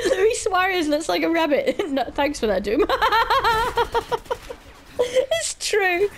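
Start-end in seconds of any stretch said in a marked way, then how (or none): 2.9-4.5 a long high-pitched giggle; high giggle fit. Real, not acted. Laughing at own joke. 5.0-6.1 squeaked out through laughter, high; laughing. Real, not acted. Laughing while insisting.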